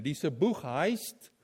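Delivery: ordinary talk, nothing marked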